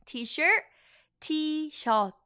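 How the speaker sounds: T-shirt said as "tee-shuh", high and clear; Korean T-shirt. Shown as a demonstration. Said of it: "So repeat after me".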